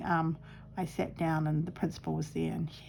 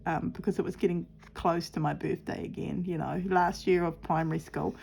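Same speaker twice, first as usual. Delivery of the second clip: ordinary delivery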